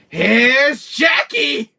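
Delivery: high, drawn-out gleeful yell; hammed-up horror villain entrance; manic villain shout. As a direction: stretch it out in a loud, high holler. Villain's Shining line, acted out.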